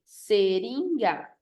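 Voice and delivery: "seringa" very slowly in three beats; syllable by syllable. Shown as a demonstration. Teacher showing a trisyllable, seringa slowly split up. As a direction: stretch seringa out, se, rin, ga. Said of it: "Trissílaba".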